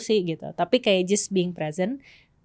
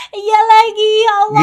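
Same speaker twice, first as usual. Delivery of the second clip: high, loud laugh-cry; real laughter breaking the words; laughing hard; 11 semitones higher, 9 dB louder than usual. Real, not acted. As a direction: lose it laughing. Laughs at partner's joke.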